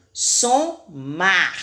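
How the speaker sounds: SOMAR split in two, strong R at the end; strong final R. Shown as a demonstration. Teacher giving another example, strong final R in "Somar". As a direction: sound out "Somar" with a strong last R. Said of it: "o som também saiu forte".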